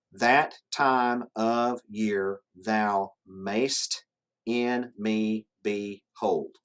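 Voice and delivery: very slow, each syllable set apart. Shown as a demonstration. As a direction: count out the verse one syllable at a time. Counting out the line's ten syllables.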